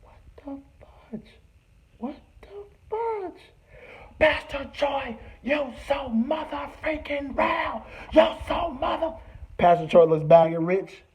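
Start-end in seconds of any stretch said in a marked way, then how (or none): 0.0-3.4 can't believe your eyes; stunned disbelief. Retold or imitated. Teller stunned by his friend's outburst, high disbelief. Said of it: "And I'm just like". 4.2-9.1 high, slow mimicking yell; mock Rich's screaming; mock fan shout. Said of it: "And I'm just like".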